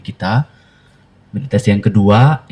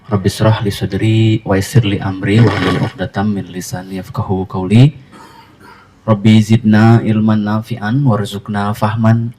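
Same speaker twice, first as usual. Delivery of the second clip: prayer recitation; 4 dB louder than usual. Real, not acted. Host prays before guiding the gathering, measured Arabic recitation. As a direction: recite it as an opening supplication.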